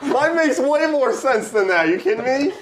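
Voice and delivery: high voice breaking into laughter; laughing. Real, not acted. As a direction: talk while cracking up. Laughing at absurd acronym.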